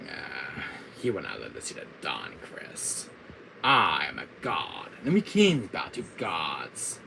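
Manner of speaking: low, grand villain pronouncement; slow, self-important would-be god; Wesker. Acted. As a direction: proclaim it as an arch-villain. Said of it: "my Wesker voice".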